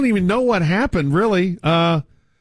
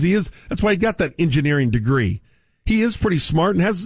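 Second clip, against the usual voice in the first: ordinary talk, nothing marked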